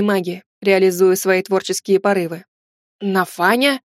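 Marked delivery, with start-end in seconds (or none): none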